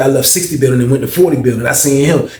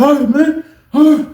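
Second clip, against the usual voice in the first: retold backing down; 12 semitones higher, 4 dB louder than usual. Snitch backs down.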